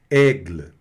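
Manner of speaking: Aigle, light trailing e; French word Aigle. Shown as a demonstration. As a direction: say Aigle, barely voicing the end. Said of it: "puxa sempre uma consoante".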